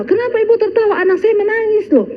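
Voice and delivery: high, fast indignant demand; indignant father. Retold or imitated. Father protests to the teacher about his son, fast and indignant.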